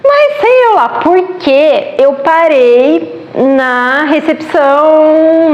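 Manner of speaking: normal talking voice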